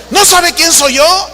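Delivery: high-pitched, imitated arrogant challenge; haughty indignation. Famous preacher repeats himself to the clerk, imitated haughty outrage. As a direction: pull rank with mock outrage. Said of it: "Habla de altanería".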